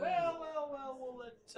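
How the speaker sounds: high, loud, flustered stammer. Acted. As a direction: scramble to smooth it over. Thastruck flustered by Dekul.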